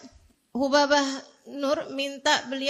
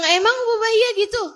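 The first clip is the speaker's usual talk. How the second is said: much higher, louder and faster in disbelief; shocked disbelief; 9 semitones higher, 6 dB louder, faster than usual. Retold or imitated. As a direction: gasp it out, unable to believe it. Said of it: "Saya bilang". (kaget tak percaya, nada jauh lebih tinggi dan keras)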